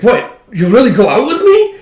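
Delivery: high, loud mock amazement; can hardly believe the date is on; astonished disbelief. Frankie astonished at accepted date.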